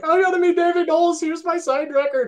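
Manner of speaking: very high, loud fan squeal; mock hysterical fan bragging; freaking out. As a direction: squeal it all on one high pitch. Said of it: "Next day, I freaked out".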